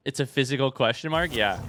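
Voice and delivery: ordinary talk, nothing marked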